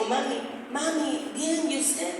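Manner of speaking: hushed, careful reassurance; gentle daughter. Retold or imitated. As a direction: speak softly to a grieving mother. Answers her weeping mother.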